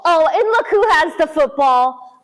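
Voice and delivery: plain reading voice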